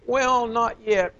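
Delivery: high, drawn-out stalling; mock reluctance. Voicing reluctant would-be helpers put off.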